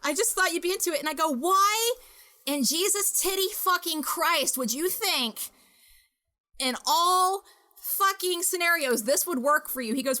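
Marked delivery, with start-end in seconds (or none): 0.0-1.0 imitating a nervous apology, raised pitch; higher, flustered imitation of someone apologising; nervous rambling apology. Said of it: "he starts rambling nervously and shaking". 1.3-9.9 slow, high-pitched incredulous demand; acted-out indignant questioning with emphatic pauses; exasperated disbelief. Retold exasperated demand for explanation.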